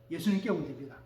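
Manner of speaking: ordinary talk, nothing marked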